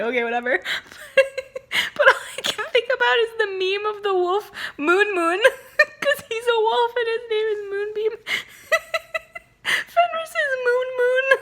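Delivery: giggling through the words, voice high; can't stop laughing at the joke; laughing hard. Real, not acted. Cracking up over wolf meme.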